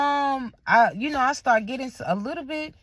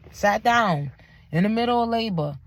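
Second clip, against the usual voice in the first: normal talking voice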